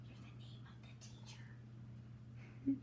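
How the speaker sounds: soft whispered aside. Real, not acted. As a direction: lean in and whisper it. Aside to the dog mid-story.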